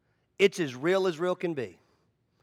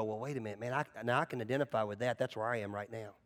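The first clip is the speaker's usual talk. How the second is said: much softer, lower, quick realization; soft recognizing; 6 semitones lower, 11 dB quieter, faster than usual. Retold or imitated. Stranger hears his story, soft, low recognition. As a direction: hush it like it just clicked. People relating to testimony.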